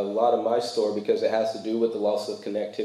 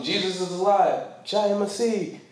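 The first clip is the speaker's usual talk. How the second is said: mock preaching; 8 semitones higher than usual. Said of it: "I'm, like, saying something".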